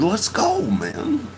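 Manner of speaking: high, pushing urgency; urging. Retold or imitated. Urging friend to get in the car, high and pressing. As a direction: coax him up to leave. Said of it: "I said".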